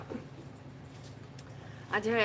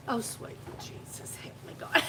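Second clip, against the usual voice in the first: breathy exasperation; 5 dB quieter than usual. Acted. Exasperated prayer-like exclamation.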